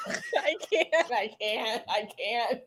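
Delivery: laughing. Real, not acted. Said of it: "I'm hysterical".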